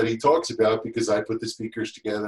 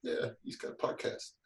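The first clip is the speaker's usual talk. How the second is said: softer, almost whispered; hushed reply; 12 dB quieter than usual. Retold or imitated. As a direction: drop to a breathy hush. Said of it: "They're like".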